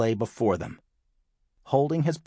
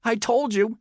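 put-on high-pitched, upset boy; blurted out; 12 semitones higher than usual. A narrator's reading. Tom to his disbelieving mom, blurting out. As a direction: blurt it high, like a wronged kid. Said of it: "he blurted out".

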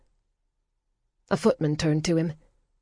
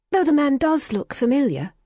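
put-on young woman voice; 10 semitones higher, 3 dB louder than usual.

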